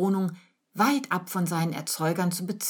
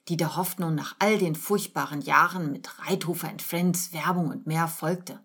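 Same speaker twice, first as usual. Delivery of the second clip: ordinary delivery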